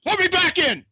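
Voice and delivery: frantic Disney shout. Retold or imitated. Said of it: "and he goes".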